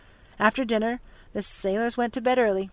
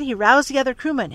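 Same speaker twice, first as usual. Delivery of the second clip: usual speaking voice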